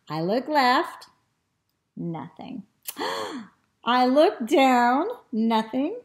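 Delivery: put-on lively, expressive children's storytelling; animated story reading. A narrator's reading. Teacher reads the dog's adventure to children, animated storytelling. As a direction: read it with big swoops and stretched key words.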